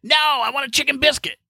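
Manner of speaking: put-on high, stubborn old-man whine. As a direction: play the grandpa repeating himself. Grandpa repeating insistently.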